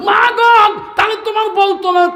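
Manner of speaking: high, loud wail of a son; anguished cry. Acted. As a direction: cry out to your mother in despair. Son cries out to mother.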